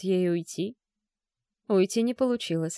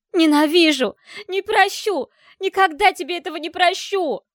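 furious screaming; 10 semitones higher, 8 dB louder than usual. Acted. Dasha screaming at Klim.

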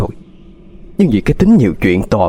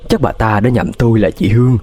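ordinary delivery, nothing marked

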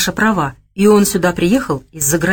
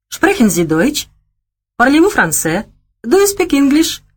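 rising, ever higher questions in German, French, English; foreign phrases; 8 semitones higher than usual. A narrator's reading. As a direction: try one language after another, each higher. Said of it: "на чистом английском".

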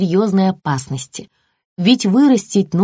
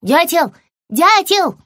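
high calls to the woodpecker, louder; calling out; 10 semitones higher, 4 dB louder, slower than usual. Acted. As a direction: shout up to the bird in the tree. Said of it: "закричала она".

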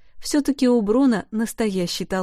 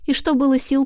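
ordinary delivery, nothing marked

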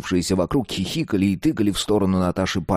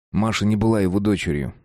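ordinary delivery

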